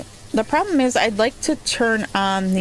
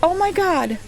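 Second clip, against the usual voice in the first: disbelief; 9 semitones higher, 4 dB quieter than usual. Real, not acted. Tornado kills colonists.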